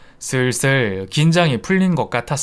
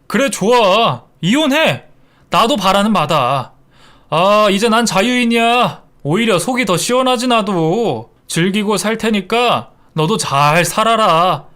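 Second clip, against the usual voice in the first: higher, louder, spitting the words back at him; defiant shout; 6 semitones higher, 3 dB louder than usual. Acted. Wife to husband at the funeral hall, ending the marriage, loud defiant shout. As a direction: shout it like slamming a door on him.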